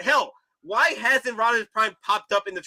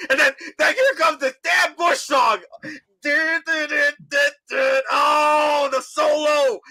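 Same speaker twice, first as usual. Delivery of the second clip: higher, shouting excitement; hyped up; 8 semitones higher, slower than usual. Real, not acted. Fan hyped about the Bush song, excited shout. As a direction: gush about the song, fired up.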